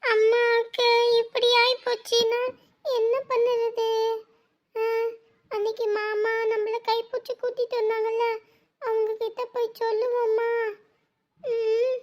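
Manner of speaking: soft, slow, worried murmur; quiet worried child. Acted. Child worrying softly about sick mother, slow and soft. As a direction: whisper your fear for mother.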